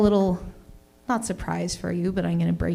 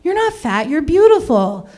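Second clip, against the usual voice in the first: protective friend; 3 semitones higher, 7 dB louder than usual. Retold or imitated. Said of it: "they're like".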